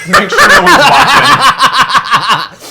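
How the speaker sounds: slow, high, loud laughing; cracking up while saying it. Real, not acted. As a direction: shout it through giggles. Laughing joke.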